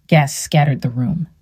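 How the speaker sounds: ordinary delivery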